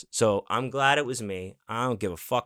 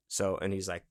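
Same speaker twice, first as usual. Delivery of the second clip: ordinary delivery, nothing marked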